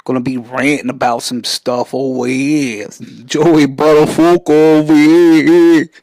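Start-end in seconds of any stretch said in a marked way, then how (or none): 3.2-5.9 loud, slow, drawn-out New York catchphrase; mock New York accent. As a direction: belt it like a Long Island tough guy. Quoting the Buttafuoco catchphrase.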